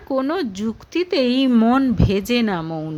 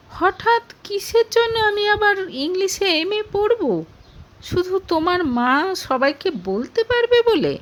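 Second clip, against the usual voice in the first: firm and raised, pitched higher; indignant young woman insisting, higher and forceful; 7 semitones higher than usual. A narrator's reading. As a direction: push back hard, voice climbing. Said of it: "জোর গলায় বলল মৌনি".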